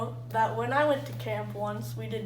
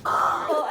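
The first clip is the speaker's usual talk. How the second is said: pained cry; 4 semitones higher, 5 dB louder than usual. Real, not acted. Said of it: "I hit my knee".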